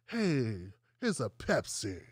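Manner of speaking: low, soft, pouty offer of a Pepsi; lip-pursed Kardashian. Retold or imitated. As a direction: pout the lips and purr it lightly. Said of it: "with the lip thing".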